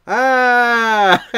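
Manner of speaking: high, loud short mock scream. Reading a silly subtitle aloud, high loud mock scream. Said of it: "Like someone screaming".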